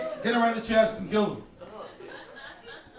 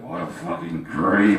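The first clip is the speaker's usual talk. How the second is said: much lower, slow disgusted growl; 12 semitones lower, 4 dB louder, slower than usual. Retold or imitated. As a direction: sneer at the creep. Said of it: "you're like".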